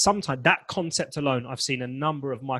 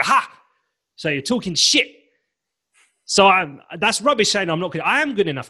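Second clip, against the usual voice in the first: louder, higher triumphant retort; defiant comeback; 5 semitones higher, 6 dB louder than usual. Retold or imitated. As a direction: fire back at the inner critic. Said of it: "And then you go".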